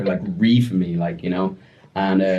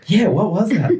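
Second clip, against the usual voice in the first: high, breezy, playing dumb; mock innocence; 11 semitones higher, 4 dB louder than usual. To the angry mother, a high, breezy, feigned innocence. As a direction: act like nothing happened.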